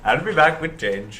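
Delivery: slow mock Indian accent shop clerk; a cashier in a put-on Indian accent. Joking to friends as a cashier, mock Indian accent. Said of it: "That was racist".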